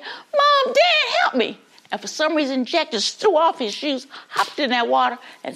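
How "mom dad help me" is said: put-on very high child's plea; children calling parents. Kids spot parents from the boat, high pleading call. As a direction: cry out as a child for rescue. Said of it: "the kids saw Mom and Dad".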